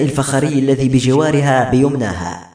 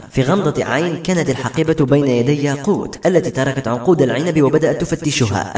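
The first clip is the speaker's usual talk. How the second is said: usual speaking voice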